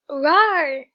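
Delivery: loud playful monster roar; silly shouted rawr; mock roar. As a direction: roar it out like a cartoon beast. Playful roar at a quiz answer.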